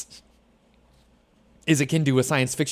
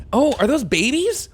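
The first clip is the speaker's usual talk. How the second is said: higher startled question; sudden alarmed surprise; real startled question; surprised; 11 semitones higher, 5 dB louder than usual. Real, not acted.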